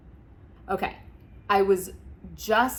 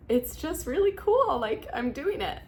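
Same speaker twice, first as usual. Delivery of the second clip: much higher, voice breaking; tearful; 8 semitones higher than usual. Real, not acted. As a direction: pride spilling over into tears. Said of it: "Why am I getting so emotional".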